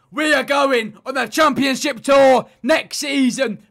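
loud, slow triumphant declaration; triumphant shout. Real, not acted. Gamer boasting about promotion, shouting slowly. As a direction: announce it like a victory speech.

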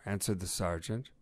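plain reading voice

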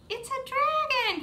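high, slow announcement; stretched-out, high-pitched excitement; excited reveal. Pretend. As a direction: reveal the creature with big wonder. Revealing the dragon to children.

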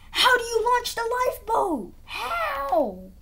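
high crying wail of mock panic; high crying wail, panicking player can't find lifeboats; mock panicked whine. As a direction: whimper it like a scared kid, high.

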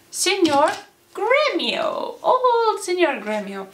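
put-on high-pitched old codger; old man voice. A narrator's reading. Storyteller bringing on old Gremio, high old-man voice. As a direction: announce him in a high old man's voice. Announces entrance of old suitor.

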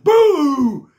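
long, high, very loud "boo"; shouted boo. A narrator's reading. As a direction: shout it out to give a scare. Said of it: "T-Bone shouted".

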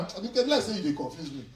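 ordinary talk

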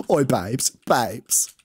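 mock British, "babes" said like "bibes"; British "babes" accent. Shown as a demonstration. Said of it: "two British I could do".

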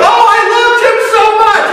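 high, loud, hammed-up cry of grief; mock wailing mourner. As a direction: overdo a hired mourner's wail over the casket. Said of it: "jump on the casket and go".

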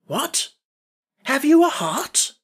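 high, astonished question; surprised. A narrator's reading. Scarecrow astonished at Jack's claim, surprised. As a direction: question it in slow, rising amazement.

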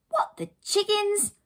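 very high, drawn-out exclamation; astonished cry. Acted. Heroine sees a car in mum's hedge, astonished cry. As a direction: cry out in slow disbelief. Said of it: "Lucy cried".

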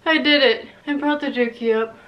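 winded after heavy lifting; out of breath. Real, not acted. Vlogger who just brought the machine up, out of breath. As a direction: gasp between short phrases. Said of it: "I'm so out of breath".

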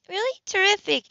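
light up with pleasure at the news; delighted. A narrator's reading.

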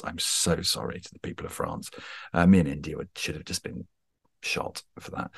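mock-guilty whisper apology; whispered aside. Real, not acted. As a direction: drop to a sheepish whisper, half joking. Joking apology for bad accents.

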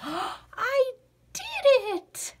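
high gasping I did it; amazed gasp. Acted. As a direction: burst out in breathless surprise. Frog succeeds in swimming.

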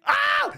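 short high squeal; quick high "ah"; panicked yelp. Pretend. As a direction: yelp while dashing for cover. Dashing past without being seen.

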